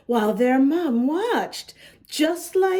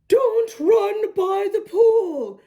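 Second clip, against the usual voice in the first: yelling; 8 semitones higher, 3 dB louder than usual. Acted. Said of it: "he could hear his mom yelling".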